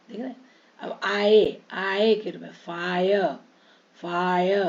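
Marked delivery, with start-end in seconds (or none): 2.6-4.6 "फायर" as faa-ya, no r; silent r. Shown as a demonstration. Teacher modelling the word, final r dropped. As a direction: stretch "फायर" and let it end on an open a. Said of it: "आर बीच में आ रहा".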